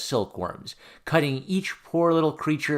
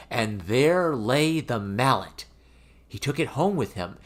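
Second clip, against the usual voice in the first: ordinary delivery, nothing marked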